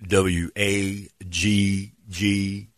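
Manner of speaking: very slow, spaced-out letters; phone agent patiently spelling it out; corrective spelling. Retold or imitated. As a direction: spell it again with each letter held apart. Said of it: "No, no".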